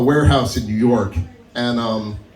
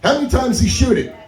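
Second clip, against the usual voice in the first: quick, raised, lightly voiced sheriff; sheriff asking; 10 semitones higher, faster than usual. Sheriff questioning mom at the scene, quick and a bit raised. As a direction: fire off the question like a cop. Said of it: "He goes".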